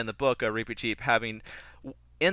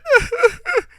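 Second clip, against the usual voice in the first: a burst of loud, high laughter; real laugh; 11 dB louder than usual. Real, not acted. Reviewer laughing at his own mock sob, loud and high. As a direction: laugh out loud at the silly impression.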